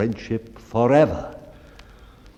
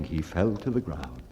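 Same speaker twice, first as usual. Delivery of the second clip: usual speaking voice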